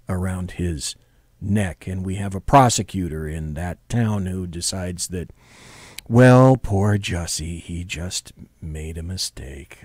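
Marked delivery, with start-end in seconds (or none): 6.1-9.8 mock sympathy. Mocking the prosecutor's excuse.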